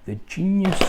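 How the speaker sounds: "The genius" blurted out high and loud by mistake; loud slip. Real, not acted. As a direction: jump in too loud on "genius".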